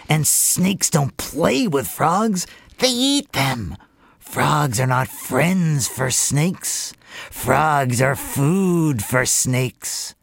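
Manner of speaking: slow, deliberate mother snake; drawn-out, menacing snake mother; stern mother snake. Acted. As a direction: warn your child, slowly and firmly. Mother Snake scolds her daughter.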